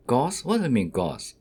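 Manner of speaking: normal talking voice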